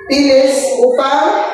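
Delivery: ordinary talk, nothing marked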